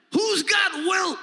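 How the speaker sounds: high shouted "got", frantic coach; panicked coach impression. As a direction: yell it like a coach losing the game. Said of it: "Here's my impression of the coach".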